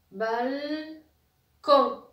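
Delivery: BALCON very slowly, long held "bal", then "con"; high, loud, stretched BAL... CON; sounded-out syllables. Shown as a demonstration. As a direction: hold the L, then punch "con". Teacher sounds out the two syllables.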